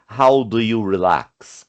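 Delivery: slow, careful question; teacher reading slowly; slow model question. Shown as a demonstration. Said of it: "olha o uso de uma question word".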